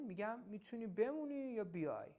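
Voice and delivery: usual speaking voice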